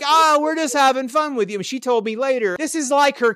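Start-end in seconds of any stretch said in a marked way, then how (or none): 0.0-1.6 high-pitched imitated friendly brush-off; jovial teasing. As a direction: voice a big guy's jolly reassurance, pitched up. Cousin voiced as jovial after hug.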